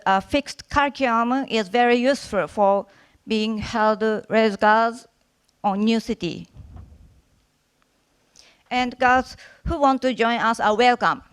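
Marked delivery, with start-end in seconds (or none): none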